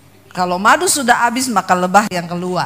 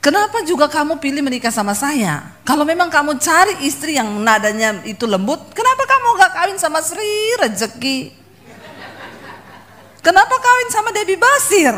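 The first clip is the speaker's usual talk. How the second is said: rising, reproachful challenge, higher toward the end; retold argument with husband; 6 semitones higher than usual. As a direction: fling the questions back at him, building up. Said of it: "Sampai saya bilang sama dia".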